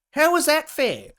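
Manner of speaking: outraged question; indignant. Real, not acted. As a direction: demand it in disbelief.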